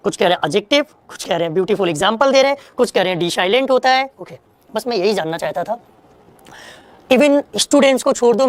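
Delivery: ordinary delivery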